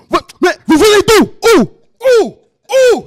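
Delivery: very loud, very high hammed-up outburst; imitated yelling. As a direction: yell like an enraged gorilla, mockingly. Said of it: "He started yelling".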